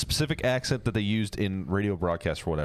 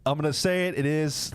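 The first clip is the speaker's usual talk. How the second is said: ordinary talk, nothing marked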